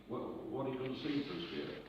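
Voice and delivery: ordinary delivery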